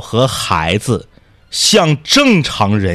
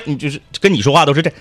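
usual speaking voice

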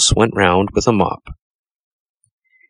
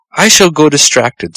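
higher, a touch louder mock scold; 7 semitones higher, 3 dB louder than usual. A narrator's reading. Mouse scolding the toad in her house, lightly fretful. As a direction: fuss at him like a flustered little mouse. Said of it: "scolded Missus Tittlemouse".

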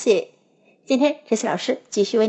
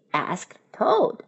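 usual speaking voice

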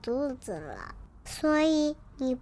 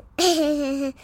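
bright loud burst of giggling; loud child giggle; 10 dB louder than usual. Acted. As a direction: laugh out loud like a delighted kid. Child laughs at name. (孩子大声咯咯笑)

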